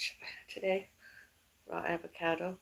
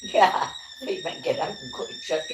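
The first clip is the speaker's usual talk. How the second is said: laughing; 7 dB louder than usual. Real, not acted. Laughing answer about church.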